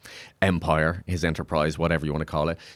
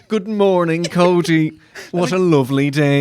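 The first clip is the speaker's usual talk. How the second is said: higher, sing-song newsreader greeting; sweet newsreader voice, pitched up; posh newsreader neighbour; 8 semitones higher, 5 dB louder than usual. Retold or imitated. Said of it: "imagine that in a slightly higher register".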